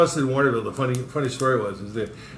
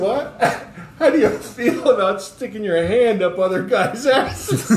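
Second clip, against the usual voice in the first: higher, laughing through the question; incredulous, laughing jab at the cop; laughing disbelief; 8 semitones higher than usual. Retold or imitated. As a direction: crack up while asking it. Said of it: "And I said".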